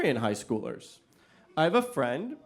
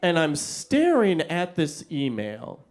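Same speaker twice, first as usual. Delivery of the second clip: usual speaking voice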